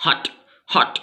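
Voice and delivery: "हट" with a short, quick vowel; very quick pronunciation. Shown as a demonstration. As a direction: cut "हट" short on the t. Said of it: "बहुत जल्दी से बोलना पड़ता है".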